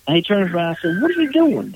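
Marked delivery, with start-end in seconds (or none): 1.0-1.7 high, startled yelp of a scared man; panicked holler. Retold or imitated. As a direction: cry out in alarm as the duck moves. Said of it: "he goes to holler".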